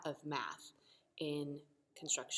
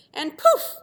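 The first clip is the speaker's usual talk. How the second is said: high, loud poof; magic poof; 17 dB louder than usual. Acted. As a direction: pop it like a spell. Magic sound effect.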